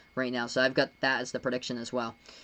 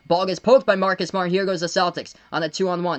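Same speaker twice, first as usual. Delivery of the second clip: higher, rising fast-break call; keyed-up basketball commentary; excited play-by-play; 3 semitones higher, 5 dB louder than usual. Real, not acted. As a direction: call the steal and break with urgency. Calls a fast break live.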